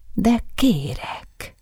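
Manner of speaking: slow, drawn-out, playfully insistent; teasing demand. A narrator's reading. Woman teasingly demanding a bite of the apple, slow and playful. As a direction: coax it out slowly, half-teasing. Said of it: "követelte a nő évődve".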